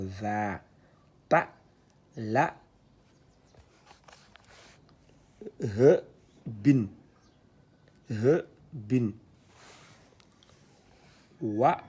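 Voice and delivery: syllabes détachées, plus fortes; lecture syllabée. Shown as a demonstration. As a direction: scande chaque syllabe. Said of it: "l'outil d'allongement". (« Wa, ma, ka, sa, ba » récité syllabe par syllabe)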